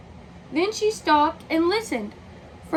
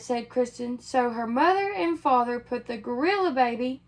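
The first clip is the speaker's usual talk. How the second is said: ordinary delivery, nothing marked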